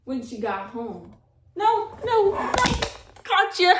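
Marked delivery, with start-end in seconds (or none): 1.5-3.8 high, loud startled no no; startled cry. Real, not acted. Reader startled when the dog knocks the tripod, surprised. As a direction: cry out as the camera falls.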